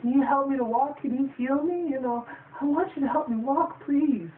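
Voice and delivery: hammed-up child asking to be healed; high imitation of a girl pleading with the magician; little girl pleading. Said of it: "She's like".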